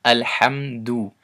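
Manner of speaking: Alhamdu drawn out slowly, each sound placed; slow careful Arabic word; slow recitation demo. Shown as a demonstration. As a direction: model the word for students. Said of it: "So one more time".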